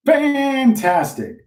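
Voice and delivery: a drawn-out, high, mocking "Fantastic"; sarcastic homeowner. Homeowner mocking the pushy agent, slow sarcastic drawl. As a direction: sneer it slowly, way up high.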